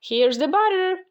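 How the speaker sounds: high storybook call; shouted. A narrator's reading. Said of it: "shouted Jack".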